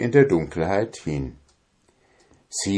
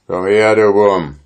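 gruff giant; 2 semitones higher, 5 dB louder than usual. A narrator's reading. Giant speaks.

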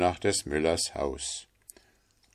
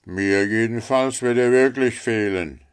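loud, higher miller's voice; self-important miller; 5 semitones higher, 7 dB louder than usual. Acted. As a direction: make a loud show of your own loss. Figure of the miller. (selbstgefälliger Müller, laut und höher)

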